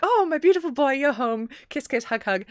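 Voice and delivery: a mother fawning over her returned son; gushing mother. Retold or imitated. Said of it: "they're like".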